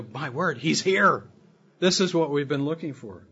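excited realization. Retold or imitated. Watchers realizing the Lord's return.

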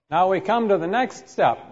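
normal talking voice

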